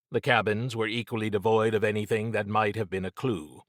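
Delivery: plain reading voice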